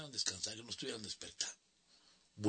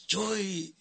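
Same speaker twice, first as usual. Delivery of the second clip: higher mock yell of a drunk fan; drunk heckler's call; 9 semitones higher than usual. Drunk fan in the audience calling out, a mild mock yell. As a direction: call out from the crowd. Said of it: "El hombre me gritaba".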